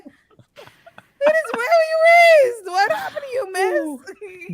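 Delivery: very high, wailing mock indignation; imitated outraged scolding. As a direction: act out an appalled scolding in a squeal. Retold outraged reply at funeral.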